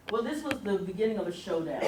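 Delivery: usual speaking voice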